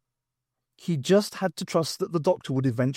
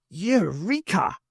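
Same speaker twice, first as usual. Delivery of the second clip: slow, higher cry of discovery; triumphant; 6 semitones higher, slower than usual. Acted. Doctor hitting on the answer, triumphant. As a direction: announce it to the world. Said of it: "it announced to the world".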